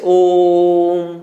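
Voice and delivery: "ओम" with the ओ held very long, about three times normal; slow teaching demo, "ओम" drawn out as the ओ sound is held; prolonged vowel demo. Shown as a demonstration. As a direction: stretch the ओ of "ओम" slowly, then close on म. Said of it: "उसमें सामान्य उच्चारण से तिगुना समय लिया".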